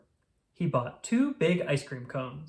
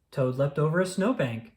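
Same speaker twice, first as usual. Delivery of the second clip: ordinary delivery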